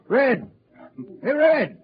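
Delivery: loud, high call to the bartender; hailing the bartender over the crowd. Acted. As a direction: shout to get the barman's attention. Calling bartender in crowded cafe.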